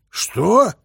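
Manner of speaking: loud, high, startled What; shouted question. A narrator's reading. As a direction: shout it into the phone in disbelief. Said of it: "закричал Гуляев".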